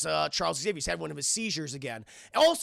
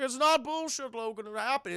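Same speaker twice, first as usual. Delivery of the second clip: higher-pitched mock protest; insistent retort; 6 semitones higher than usual. Someone contradicting Logan over the comics, high insistent retort. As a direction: protest in a lifted voice. Acting out Charles arguing with Logan.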